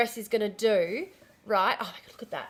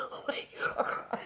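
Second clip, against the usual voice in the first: laughing whisper; 11 dB quieter, slower than usual. Real, not acted. Laughing at forgetting again.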